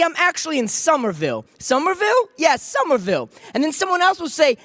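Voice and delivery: high, lively back-and-forth between strangers; animated, rapid-fire acted dialogue; excited small talk. Strangers excitedly find mutual acquaintances.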